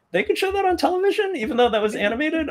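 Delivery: very high incredulous squeak; mock shocked disbelief. Viewer retelling their shock at an animated TV clip, scandalised. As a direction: clutch your pearls in high-pitched disbelief.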